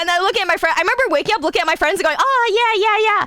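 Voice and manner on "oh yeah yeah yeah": excited. Retold or imitated. Acted waking-up enthusiasm.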